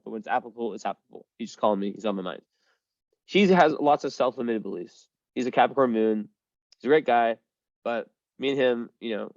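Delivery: ordinary delivery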